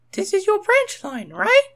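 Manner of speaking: very high, loud questioning engine voice; Rosie's high voice. A narrator's reading. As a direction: ask brightly in a high girl engine voice. Rosie asks Thomas.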